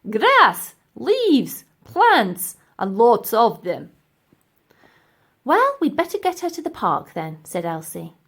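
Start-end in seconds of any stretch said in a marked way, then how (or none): 0.1-3.9 slow, pompous professor; a haughty old professor, slow and loud. Acted. Professor lecturing the ladies, slow pompous voice. 5.4-7.4 put-on high, eager young girl; a bright little girl's voice, pitched higher. Elsie urging the professor, high girlish voice.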